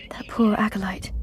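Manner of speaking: whispered grief; hushed mourning; sad whisper. Acted. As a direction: breathe it out in sorrow. Mourning the dead acolyte.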